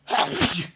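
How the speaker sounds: big high sigh that falls away; loud sigh. Real, not acted. Tired streamer lets out a big loud sigh. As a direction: let out a long, loud sigh.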